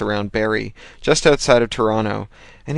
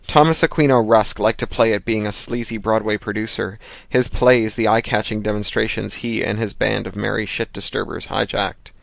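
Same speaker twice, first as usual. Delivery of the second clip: ordinary delivery, nothing marked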